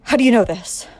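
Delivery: loud, alarmed demand; villain cornered, loudly demanding answers; exclaimed. Acted. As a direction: bark it out in shock.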